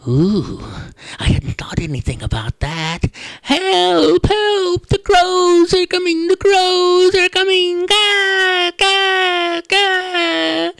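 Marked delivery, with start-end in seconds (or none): 3.4-7.9 very high, loud squeaky cries; panicked field mice. Acted. 7.9-10.7 high loud cawing; crow caws. Acted. Mice mimic crows.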